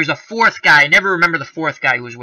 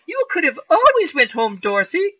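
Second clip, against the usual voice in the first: higher, sugary-sweet mock witch voice; mock Glinda; 11 semitones higher than usual. Host mimicking Glinda scolding Dorothy, high mock voice. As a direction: put on the good witch talking down to Dorothy. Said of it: "she's like".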